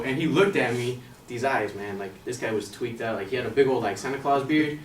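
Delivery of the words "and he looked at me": plain reading voice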